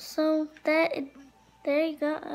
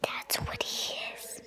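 whispering; 11 dB quieter than usual. Real, not acted. Whispered aside.

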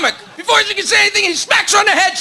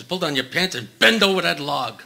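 bear voice; 10 semitones lower, 7 dB quieter than usual. Acted.

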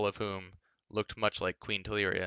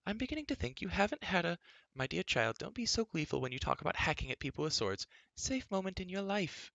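much higher, fluttery scolding voice; fussy queen; 10 semitones higher than usual. A narrator's reading. Queen Teleria scolding the princess, much higher fussy voice. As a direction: fret like a prim old queen.

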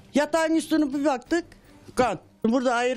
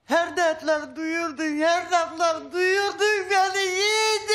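lament; 5 semitones higher, slower than usual. Real, not acted. Widow laments her dead husband.

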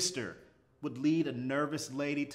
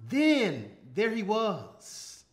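excited; 8 semitones higher, 5 dB louder than usual. A narrator's reading. Excited reunion at the platform.

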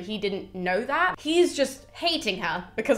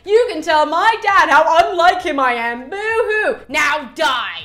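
sneering, louder mock villain; taunting villain; 6 semitones higher, 7 dB louder than usual. Pyrrhus taunting old Priam before killing him, sneering voice. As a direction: gloat over the old king. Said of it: "he says".

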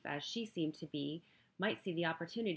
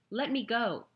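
demanding; 6 semitones higher, 8 dB louder than usual. Acted. Heroine demands release.

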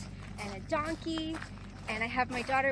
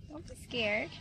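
ordinary talk

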